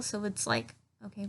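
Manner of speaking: ordinary talk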